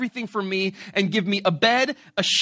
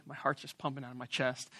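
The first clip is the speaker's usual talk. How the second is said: ordinary delivery, nothing marked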